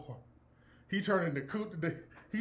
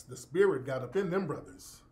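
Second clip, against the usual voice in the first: ordinary delivery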